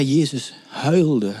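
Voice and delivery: Jezus huilde slowed right down, each word weighed; moved emphasis. Real, not acted. Preacher moved by the verse, slow and weighty. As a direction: let Jezus huilde land slowly, touched. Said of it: "en het raakt me elke keer weer".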